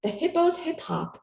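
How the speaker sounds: usual speaking voice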